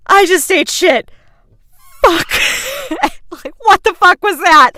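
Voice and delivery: high, loud, horrified cry; shocked outburst. Retold or imitated. Mom who just tasted poop, shocked and loud. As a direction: blurt it out in disgusted shock.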